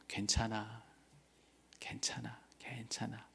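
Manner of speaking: soft, fading whisper of a mother; mother soothing a frightened child, whispering softly; tender reassurance. Retold or imitated. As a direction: hush the child gently with each word. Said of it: "이 엄마의 따뜻한 말에".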